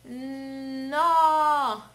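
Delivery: NO with a held N swelling into a loud long O; nnnooo, drawn out and loud; blending letter sounds. Shown as a demonstration. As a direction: hum the N, then burst into "No". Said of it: "vamos falar o sonzinho da letrinha N".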